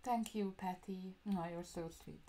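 ordinary talk, nothing marked

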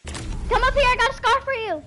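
high shouted call across the map. Real, not acted. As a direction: call out to a far teammate. Calling teammate over.

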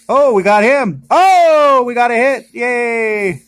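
high, loud whoop of delight; real burst of excitement; excited cheer. Real, not acted. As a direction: cheer the lucky pull, voice leaping up. Getting a hit in a pack.

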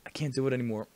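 soft attempt at a game-hero voice; Snake. Shown as a demonstration. Player testing a failing hero impression, soft voice. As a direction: try the hero voice quietly. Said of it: "it's more like".

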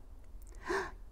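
a high, breathy gasp of surprise; sharp gasp. Acted. Grandma sees Jack at the door, sharp gasp. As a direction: gasp in sudden delight. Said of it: "gasped Grandma".

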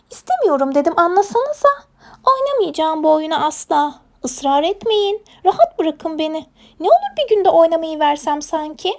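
high-pitched protesting little creature; stubborn hedgehog. Acted. Hedgehog refuses the game, high stubborn protest. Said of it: "tutturdu bizim kirpi oynamayacağım diye".

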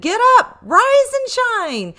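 high, loud wake-up shout; shouted wake-up call. A narrator's reading. Dad shouting the family awake for the trip, loud and high. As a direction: bellow it like Dad rousing the house before dawn. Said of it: "Dad shouted".

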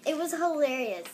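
ordinary delivery

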